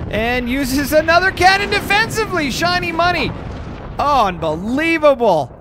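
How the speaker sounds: high-pitched, amazed commentary; excited play-by-play. Real, not acted. Caster calling another defensive cannon play, amazed. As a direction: climb high, then stretch the last word in disbelief.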